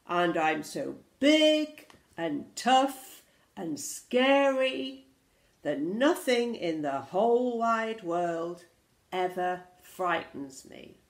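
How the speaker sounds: loud, slow boastful monster; big swaggering dinosaur roar. Acted. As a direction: boom each boast out with drawn-out words. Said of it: "roared the dinosaur".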